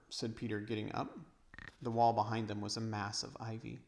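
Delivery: usual speaking voice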